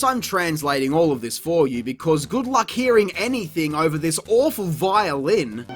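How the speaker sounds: usual speaking voice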